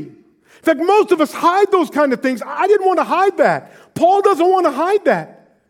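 high, loud preaching build; impassioned preaching. Acted. Preacher telling the congregation of real reconciliation, impassioned. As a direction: preach it out with conviction.

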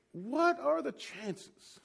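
high, drawn-out wonder; acted amazement. Schoolmate marvels at the chance roadside meeting, amazed. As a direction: stretch it out slowly in disbelief.

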